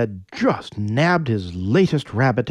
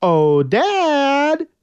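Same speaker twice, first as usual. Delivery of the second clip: put-on high, loud girlish call; girls calling; 13 semitones higher, 3 dB louder than usual. The seven daughters calling their father, high and loud. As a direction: call to dad for help. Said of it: "they called".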